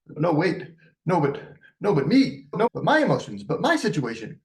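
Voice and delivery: high, whiny me-me-me protest; hammed-up whining about my emotions; mock self-centered whine. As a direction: parent stealing the teen's thunder, whining. Mocking parent making it about themselves.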